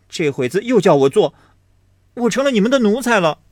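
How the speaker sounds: high, fast, loud bitter complaint rising to the end; young lady sneering at the maid in growing anger; cold sneer. Acted. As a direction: spit out the hurt pride. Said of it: "史湘云冷笑道".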